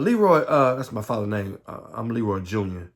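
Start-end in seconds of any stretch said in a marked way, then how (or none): 0.0-0.4 mother calling her husband. Retold or imitated. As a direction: call him in from the yard.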